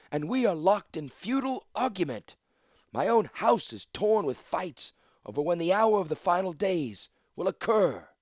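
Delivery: high, forceful public oration; rally the crowd with a grave warning; ringing leader's speech; impassioned declaration. A narrator's reading. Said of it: "Latilla declared as all eyes locked".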